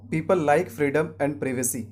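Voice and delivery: slow sentence, privacy as "PRIV-uh-see"; British pronunciation. Shown as a demonstration. Teacher gives an example, privacy starting "priv". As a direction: say privacy with short first vowel. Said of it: "इसका सही उच्चारण होगा प्रिवेसी".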